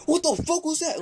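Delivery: very high-pitched mock outburst; imitating outraged neighbor. Neighbor reacts to his kicked door, imitated outrage. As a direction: imitate an outraged man, voice jumping way up.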